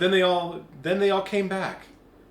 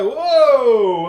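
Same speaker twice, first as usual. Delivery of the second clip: loud startled whoa; 6 semitones higher, 14 dB louder than usual. Retold or imitated. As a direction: react loudly as it swings back. World swinging back.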